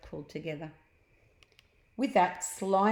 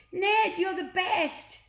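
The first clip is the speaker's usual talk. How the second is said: high, loud, slow cheer; shouting kids; 12 semitones higher, 5 dB louder, slower than usual. Acted. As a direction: cheer him on from the grass. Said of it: "they shouted".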